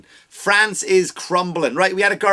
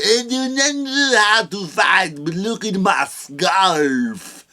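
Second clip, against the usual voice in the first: very slow, put-on French accent; a limp Frenchman showing off his scarf; mock French voice; 3 semitones higher, much slower than usual. Mocking a French man who cannot fight.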